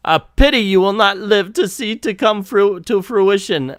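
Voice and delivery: put-on faltering German-accent villain line; bad German accent. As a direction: stumble through a German accent. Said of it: "I can't do German accent".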